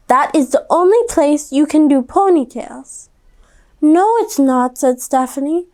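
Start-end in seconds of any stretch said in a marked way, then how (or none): 0.1-3.1 mother. A narrator's reading. Mom in story. 3.8-4.8 put-on stubborn girl. A narrator's reading. Stephanie refusing mom.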